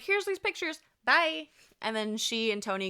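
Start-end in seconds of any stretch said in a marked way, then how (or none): 0.0-1.5 breezy high quip. Retold or imitated. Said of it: "kinda is just like".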